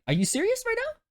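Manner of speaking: very high, indignant disbelief; high-pitched retold protest; incredulous complaint. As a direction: squeak it out in mock outrage. Retold disbelief at being kicked out.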